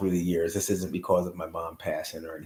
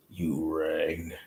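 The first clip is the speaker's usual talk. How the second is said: Lurch; 3 semitones lower, 4 dB quieter than usual. Retold or imitated. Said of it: "or Lurch, should I say".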